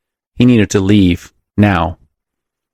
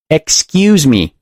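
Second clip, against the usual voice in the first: high, stretched-out demand for attention; impatient call; 7 semitones higher, slower than usual. A narrator's reading. Woman thumping beds at tall man, impatient call. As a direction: insist on being let through. Blocked woman calls out again.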